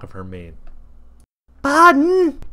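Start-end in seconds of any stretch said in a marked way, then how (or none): 1.6-2.4 loud, high call from upstairs; stern call. A narrator's reading. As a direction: call the boy's name sharply from another floor. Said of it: "called a stern voice from upstairs".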